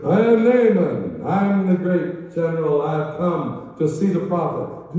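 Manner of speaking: loud, high, slow self-important announcement; pompous booming self-introduction; proud general. Acted. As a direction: puff up like a great general at the door.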